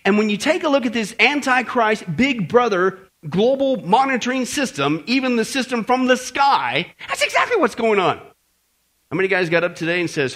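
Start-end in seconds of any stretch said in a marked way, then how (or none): none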